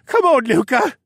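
high, loud, whiny plea; pleading. A narrator's reading. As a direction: beg your partner to give in. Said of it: "the first one pleaded".

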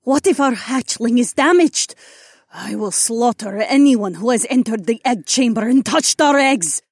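high, loud furious threat; seething protective mother; furious dragon woman. Acted. Dragon mate threatens intruder.

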